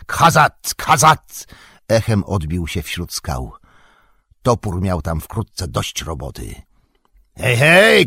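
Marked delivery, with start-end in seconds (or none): 0.1-1.5 high, loud battle yell; wild war cry. A narrator's reading. Gimli leaping down on the orcs, wild battle cry. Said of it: "dziki okrzyk". 7.4-8.1 loud, high battle shout. A narrator's reading. Dwarf hailing comrades in battle, shouting. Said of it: "krzyknął Gimli".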